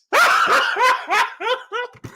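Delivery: long high real laughter; helpless laughing at the list. Real, not acted. Laughing at the ranking.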